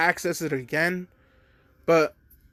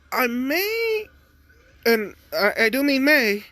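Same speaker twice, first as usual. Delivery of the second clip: nervous son; 9 semitones higher, slower than usual. Retold or imitated. Deku voiced, nervous.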